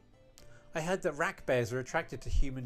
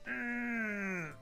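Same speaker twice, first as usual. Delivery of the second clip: long high closed-mouth groan, gliding down; frustrated whine; 7 semitones higher, 4 dB quieter than usual. Acted. Losing the argument with friends, frustrated whine. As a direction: whine through shut lips.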